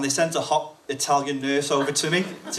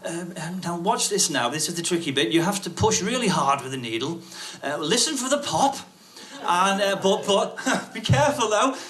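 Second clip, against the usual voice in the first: higher-pitched, chatty instructor voice; lightly put-on higher clinician voice; anaesthetist instructing trainee; 6 semitones higher than usual. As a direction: talk a learner through it in a raised voice. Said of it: "the anaesthetist is there going".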